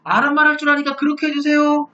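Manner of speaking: high, held-pitch whiny begging; servile pleading. Retold or imitated. Envoys begging him to speak the other language, servile. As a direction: plead abjectly as a cowed envoy. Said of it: "이렇게 비굴하게 얘기를 했어요".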